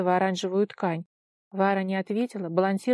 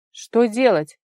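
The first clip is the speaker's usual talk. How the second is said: loud question; 2 semitones higher, 10 dB louder, slower than usual. A narrator's reading. Tigir asks his friend over roaring wind, loud shout. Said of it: "громко спросил Тигир, стараясь перекричать ветер".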